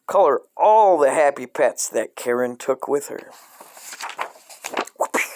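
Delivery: ordinary talk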